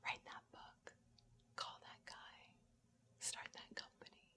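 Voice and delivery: soft, unvoiced-breath whisper with pauses. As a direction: whisper it like a quiet inner prompting. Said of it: "oftentimes intuition whispers".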